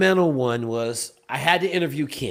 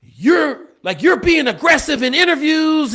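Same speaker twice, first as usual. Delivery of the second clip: accusing bosses; 12 semitones higher, 6 dB louder than usual. Retold or imitated. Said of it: "So they were like".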